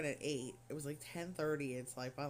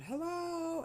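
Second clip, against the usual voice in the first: imitated yelling; 12 semitones higher than usual. Older patient calls from his bed for a nurse, imitated yelling. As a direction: call out like an old man wanting attention. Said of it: "He kept yelling".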